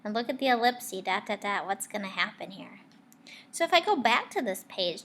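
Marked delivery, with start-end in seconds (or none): 1.7-2.8 fast whisper; fast whispered question; suspense whisper. Real, not acted. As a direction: tease the suspense. Suspense for child listeners.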